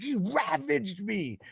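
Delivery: high, slow whiny voice; mock whine. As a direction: drag it out like a sore loser. Voicing the whining player.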